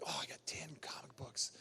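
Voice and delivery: hushed, whispered awe; awed whisper. Retold or imitated. Collector marvelling at his tiny comic collection, awed whisper. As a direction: whisper it like a precious secret.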